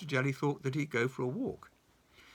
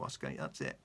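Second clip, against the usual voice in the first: ordinary delivery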